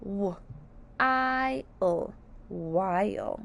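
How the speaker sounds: slow, letter by letter then blend; sounding out letters. Shown as a demonstration. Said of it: "let's sound out while together".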